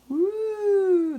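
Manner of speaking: high, loud whoop at the heat of the pepper; thrilled whoop. Real, not acted. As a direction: let out a real whoop of daring. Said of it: "that was adventurous".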